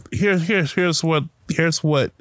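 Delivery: usual speaking voice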